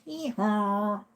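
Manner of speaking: high, soft mock hee-haw; donkey bray imitation. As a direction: imitate a braying donkey for the dog. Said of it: "Don't hee-haw with the donkeys".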